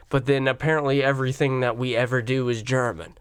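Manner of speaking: normal talking voice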